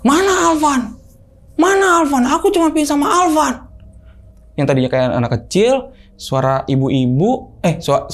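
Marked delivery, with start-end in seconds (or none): 0.0-3.6 high-pitched possessed granny impression; hoarse granny voice. Possessed girlfriend wanting only Alvan, high hoarse granny voice. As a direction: put on a high, strained old woman's voice. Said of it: "suara kayak nenek-nenek tapi serak".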